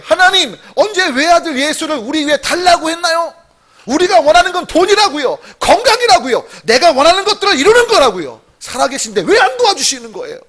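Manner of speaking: high, loud indignant protest; acted demanding outburst; angry complaint to God. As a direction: imitate an ungrateful child railing at a parent. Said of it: "이 아들이 "엄마, 누가 언제 그걸 팔랬어?" 한 것처럼".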